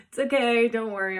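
high, loud playful reassurance; loud mock reassurance. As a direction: loudly wave away their worry. Jokingly reassures the couple.

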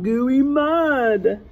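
ordinary delivery, nothing marked

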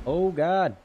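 shocked. Real, not acted. Still shocked by video.

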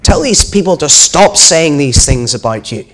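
a mildly acted grumble with force on the demand; grumbling. A narrator's reading. Religious leaders to Jesus about the crowd, grumbling. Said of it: "they grumbled to Jesus".

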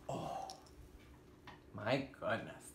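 ordinary delivery, nothing marked

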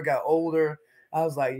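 normal talking voice

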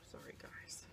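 soft unvoiced whisper. Real, not acted. Apologizing to viewers while pulling herself together, whispered. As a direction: breathe it out to yourself.

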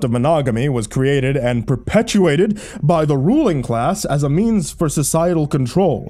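griping rant. A narrator's reading. Jerry rants about monogamy.

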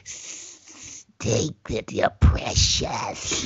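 hushed, breathy, slow mock bully; imitating a sleazy bully. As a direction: coax it like a creepy bully. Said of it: "it was like".

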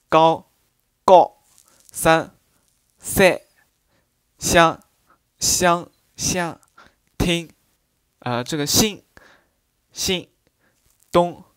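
高 三 香 心 动 each falling from high to mid; Ningbo 53 tone. Shown as a demonstration. Ningbo teacher demonstrating the gently falling tone. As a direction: say each word with a short high-to-mid fall. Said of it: "比如说 天呢".

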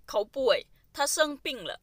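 usual speaking voice

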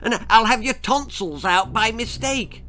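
high fast fairy scold. Acted. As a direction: snap a warning, pitched high.

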